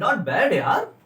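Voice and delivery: slow, drawn-out admiring not bad. Shown as a demonstration. Teacher demos warm praise for a good idea. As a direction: savour the compliment. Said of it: "ये word बोलो आप".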